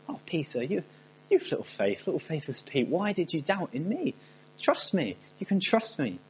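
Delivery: soft, tender, halting reproach; gentle reassurance. Acted. Jesus walking hand in hand with Peter, gently reassuring. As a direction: coax softly, lifting into the question. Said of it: "walking back with him hand in hand saying".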